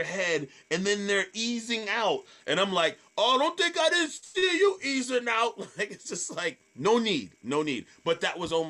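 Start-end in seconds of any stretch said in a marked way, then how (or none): 3.2-5.6 high, loud teasing shout; mocking call-out. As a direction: holler at someone caught sneaking away. Calling out person sneaking out.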